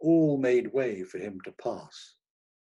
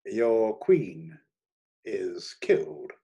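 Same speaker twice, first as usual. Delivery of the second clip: ordinary delivery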